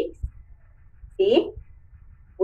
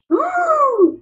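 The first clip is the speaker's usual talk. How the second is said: delighted oh; 13 semitones higher than usual. Real, not acted. Teacher exclaims after presentation.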